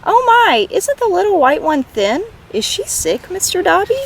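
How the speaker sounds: a hint of children cooing over a kitten; concerned children. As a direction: sound like fretting schoolchildren. Children fussing over thin kitten.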